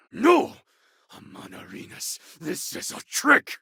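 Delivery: sharp high "No!" then urgent warning; alarmed cry. Acted. Companion warning about Amon Arenas's trick, alarmed cry. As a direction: sound the alarm.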